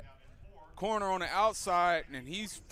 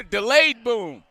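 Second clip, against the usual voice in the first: delayed boom call; 4 semitones higher, 12 dB louder, slower than usual. Real, not acted. Color man celebrating touchdown laughing.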